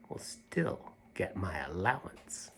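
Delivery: unvoiced, sneaky whisper. The girl whispers her sly plan about the allowance. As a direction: whisper it like a secret. Girl ends defiant speech secretly.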